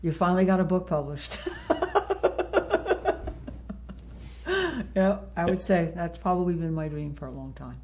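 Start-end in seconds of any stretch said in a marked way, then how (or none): 1.4-4.9 high breathy laugh tipping toward tears; laugh, nearly crying, overcome; emotional laugh. Real, not acted. Author laughing as she imagines her first book published.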